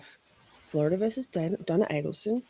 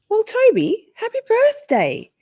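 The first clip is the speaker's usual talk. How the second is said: bright, high-pitched birthday greeting; high excited birthday wish; 5 dB louder than usual. Real, not acted. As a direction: sing out the birthday wishes.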